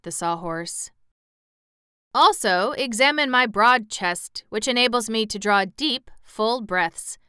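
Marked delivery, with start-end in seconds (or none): 2.1-7.2 raised, emphatic boast; proud. A narrator's reading. Cab horse on his broad chest, proudly. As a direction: show off with a touch of pride. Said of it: "said Jim proudly".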